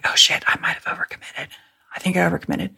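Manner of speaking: hushed whisper, as if the instructor mustn't hear; whispered panic. Retold or imitated. First to jump from the plane, whispering her panic. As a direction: breathe it out in a scared whisper.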